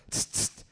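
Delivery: voiceless imitated spray-can hiss; spray paint hiss. Dad spray-paints raccoon tail.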